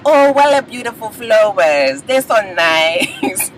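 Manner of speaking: mock-sweet admiration with a long, drawn-out ending; imitated admiring reaction. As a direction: coo over a gift, stretching the last word. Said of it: "She is just like".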